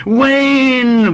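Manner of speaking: high, loud, drawn-out insistence; persistent small boy. A narrator's reading. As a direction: dig in like a stubborn schoolboy. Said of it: "repeated the child".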